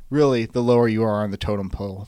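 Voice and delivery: usual speaking voice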